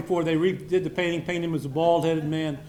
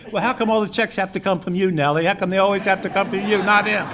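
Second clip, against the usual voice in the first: louder, rising mock indignation at Nellie; hammed-up complaint, getting louder to the end; mock exasperated outburst; 4 semitones higher, 6 dB louder than usual. Speaker teasing a friend's wife about who pays, in mock outrage.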